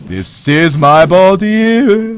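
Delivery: lightly acted, long drawn-out words; mock player voice. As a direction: stretch every word slowly as the player. Voices players in slow drawl.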